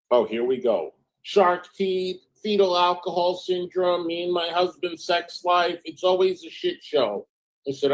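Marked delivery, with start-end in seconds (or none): 1.2-7.3 higher, whiny mock complaint; mocking whiny list. Reading the friend's complaints aloud, lightly mocking and whiny. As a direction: whine the list as a parody.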